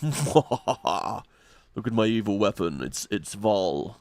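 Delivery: very slow, low, quiet sinister villain; slow creepy villain murmur; evil villain voice. Acted. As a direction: savour every word like a dark sorcerer. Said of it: "And he's like".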